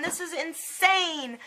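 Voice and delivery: high, slowed exclamation of disbelief; exasperated disbelief. Retold or imitated. Patient upset at her primary, exasperated disbelief. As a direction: stretch "insane" out on a high pitch. Retold outburst, said twice.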